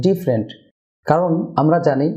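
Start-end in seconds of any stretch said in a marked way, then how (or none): 0.1-0.5 "ডিফারেন্ট" in two syllables, DIF-rent; correct pronunciation. Shown as a demonstration. Teacher models for viewers, "ডিফারেন্ট" as DIF-rent. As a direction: drop the middle vowel of "ডিফারেন্ট". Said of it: "দুটি syllable হবে".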